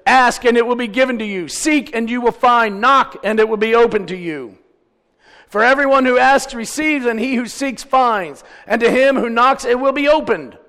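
loud, high, driving recitation; scripture proclamation. Acted. Preacher quoting Jesus from Matthew seven, proclaiming. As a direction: declaim the verse with preaching force.

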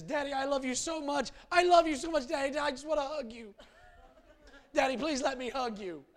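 put-on high, pleading little boy voice; pleading child. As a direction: beg like a clingy scared child.

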